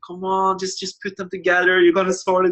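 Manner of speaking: egging on a base jumper in line twists; urging on. Retold or imitated. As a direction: cheer a stuck jumper on. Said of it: "I'm just like".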